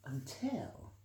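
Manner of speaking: ordinary delivery, nothing marked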